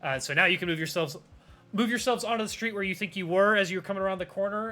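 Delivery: ordinary delivery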